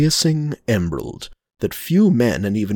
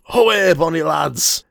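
mild shout; 7 semitones higher, 3 dB louder than usual. Acted. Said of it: "a shout of".

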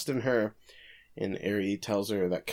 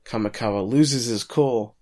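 ordinary talk

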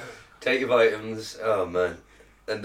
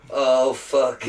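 ordinary talk

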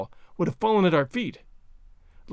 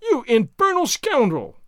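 slow, higher exclamation; exasperated cry; 5 semitones higher, 4 dB louder, slower than usual. A narrator's reading. Treasure hunter scolds servant after a scare, exasperated cry. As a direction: scold him with some relief underneath. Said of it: "cried Legrand, apparently much relieved".

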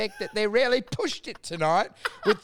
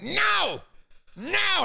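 high mock angry no; 12 semitones higher than usual. Audience woman snapping at the comedian, mock angry. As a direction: imitate her huffy denial, much higher. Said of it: "like, angry".

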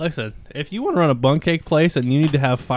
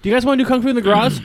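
much higher, louder, fast hyped question; goofy excited invite; 11 semitones higher, 8 dB louder, much faster than usual. Pretend. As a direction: blurt it out like an eager kid.

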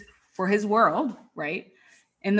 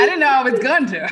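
laughing; 6 semitones higher, 8 dB louder than usual. Real, not acted. Laughing reply.

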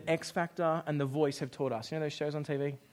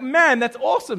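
thrilled reaction; 6 semitones higher, 10 dB louder than usual. Retold or imitated. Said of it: "I'd be like".

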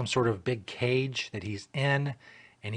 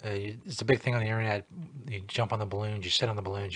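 ordinary talk, nothing marked

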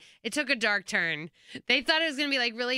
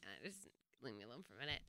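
retold in a tiny, very low murmur; small upset voice; 10 semitones lower, 24 dB quieter, faster than usual. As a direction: drop far down, barely audible, as if wanting to be left alone. Retelling her upset reply to colleague.

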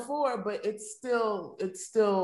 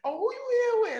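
teasing acquaintances; 12 semitones higher, faster than usual. Retold or imitated.

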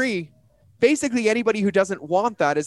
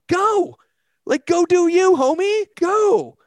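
much higher, louder shout of encouragement; excited send-off; 11 semitones higher, 5 dB louder, much slower than usual. Retold or imitated. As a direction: cheer him out the door. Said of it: "And I'm like".

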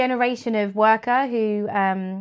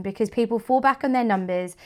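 normal talking voice

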